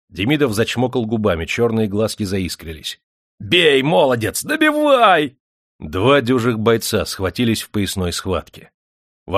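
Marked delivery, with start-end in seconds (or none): 3.4-5.4 very high, loud, drawn-out cheer; excited yell. A narrator's reading. Master urges miner to finish his opponent, excited yell. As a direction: scream for the finishing blow.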